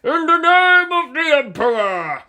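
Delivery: high, loud zealous war cry; battle cry. A narrator's reading. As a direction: shout it like a crusading soldier.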